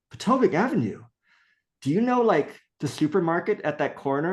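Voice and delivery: high-pitched, incredulous echo; amazed recognition. Retold or imitated. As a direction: imitate someone astonished at a coincidence. Other man recognises the street.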